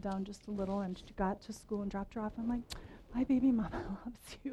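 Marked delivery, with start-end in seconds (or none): 3.1-4.5 whispered tenderness. Retold or imitated. Mother making up with her daughter at the school drop-off, whispered. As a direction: whisper it like a sheepish apology.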